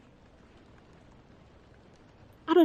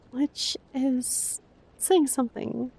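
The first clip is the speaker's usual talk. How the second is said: ordinary talk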